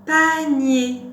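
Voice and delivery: loud, high, deliberate model word; word dictation. Shown as a demonstration. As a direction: call out each syllable clearly for a learner. Teacher dictates word for syllable listening.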